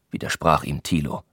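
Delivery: plain reading voice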